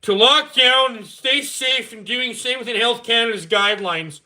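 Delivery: high, loud, slow mock Trudeau; drawn-out pious politician's statement, hammed up. Mocking Trudeau announcing precautions again, high and slow. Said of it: "he goes".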